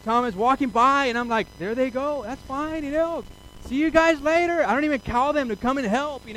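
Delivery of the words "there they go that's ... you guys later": breezy dismissal. Pretend. Casually dismissing doctors.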